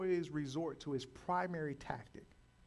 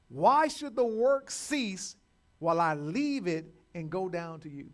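slow, emphatic scripture reading, higher and louder; 5 semitones higher, 9 dB louder, slower than usual. Acted. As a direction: proclaim the verse phrase by phrase. Reads scripture aloud.

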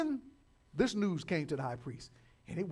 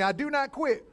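loud, higher call to repeat; declaration; 7 semitones higher, 10 dB louder than usual. Shown as a demonstration. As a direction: lead the congregation in the line. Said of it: "say it with me".

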